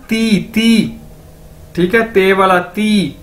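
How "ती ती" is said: ती with a soft dental t, not hard टी. Shown as a demonstration. Teacher correcting students: ती with a soft dental t. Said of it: "टी नहीं इसको कहना".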